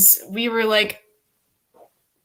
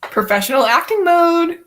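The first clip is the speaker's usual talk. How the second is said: mock announcement; 8 semitones higher, 4 dB louder than usual. Announces acting mode in mock showy voice.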